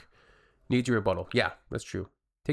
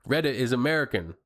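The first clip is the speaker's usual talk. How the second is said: ordinary delivery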